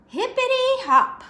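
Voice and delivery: high, loud bunny cheer; excited exclaim. Acted. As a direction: burst out with glee. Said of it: "Hoppy exclaimed".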